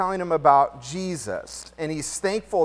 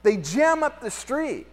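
normal talking voice

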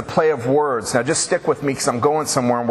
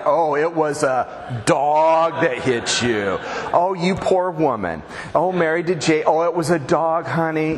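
drawn-out, skeptical fake pity; oh-so-knowing mock concern; mock sympathy. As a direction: mimic disbelieving churchgoers cooing over a poor wife. Mimics churchgoers' disbelieving fake sympathy.

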